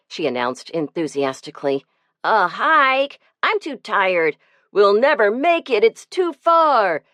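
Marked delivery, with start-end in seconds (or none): 2.2-7.0 put-on whiny, complaining kids; high, whiny protest from a pack of boys; groaning boys. A narrator's reading. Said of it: "they groaned in protest".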